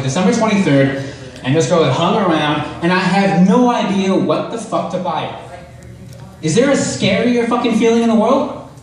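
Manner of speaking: ordinary talk, nothing marked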